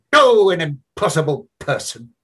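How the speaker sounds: loud, high exasperated exclamation; exclaiming. Acted. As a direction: throw it out in mock despair. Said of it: "he exclaimed".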